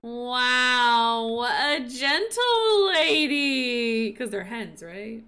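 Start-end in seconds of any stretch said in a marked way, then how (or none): 0.0-4.1 slow, louder, near tears; tearful wonder. Real, not acted. Admiring her hen, slow and teary. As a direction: coo it out, teary. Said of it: "I'm trying not to sniffle".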